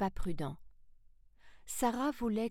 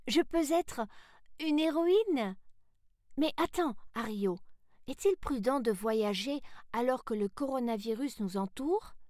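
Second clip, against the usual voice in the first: put-on high, excited girl's voice turning to a question; young Sarah, excited then concerned; 5 semitones higher than usual. Sarah checks with Ario before travelling, high and eager. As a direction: start high and thrilled, then ask keenly.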